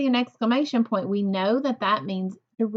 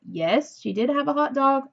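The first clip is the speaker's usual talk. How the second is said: usual speaking voice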